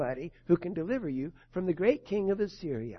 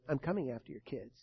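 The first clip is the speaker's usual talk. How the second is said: lightly voiced evil one, lower and softer; 5 semitones lower, 5 dB quieter than usual. Preacher voicing the evil one threatening the listener's kids, low menace. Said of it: "says the evil one".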